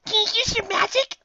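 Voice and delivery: high squeaky plea; high anxious question. Acted. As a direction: ask hopefully. Character asks urgently.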